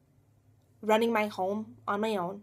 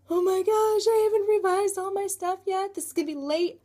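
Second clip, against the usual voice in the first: much higher and louder, frantic; panicked; 10 semitones higher, 4 dB louder than usual. Retold or imitated. Retelling her panic over a late binder, frantic and high. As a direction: rush it out in a panic. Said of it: "I'm like".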